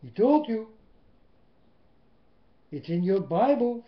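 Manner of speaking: loud, rising preacher calls on through; full-force preaching. Acted. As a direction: hammer it home from the pulpit. Preacher drives the point home.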